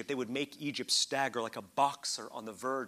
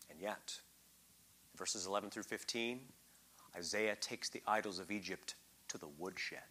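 whisper; 6 semitones lower, 9 dB quieter than usual. Acted. Preacher relishing the prophet's rebuke.

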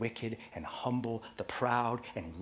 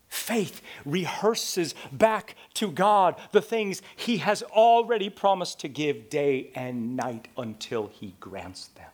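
normal talking voice

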